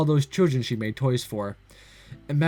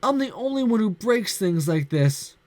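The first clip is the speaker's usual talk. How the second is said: tearful; 7 semitones higher than usual. Acted. Girl Santa in despair.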